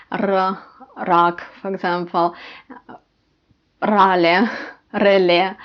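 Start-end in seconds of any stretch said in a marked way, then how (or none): 0.1-1.4 R and rak with a trilled tongue-tip R; rolled R. Shown as a demonstration. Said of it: "you do need to roll your tongue". 3.8-5.6 rale, rele with a strongly trilled R; rolled R. Shown as a demonstration. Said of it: "you will need to roll your R".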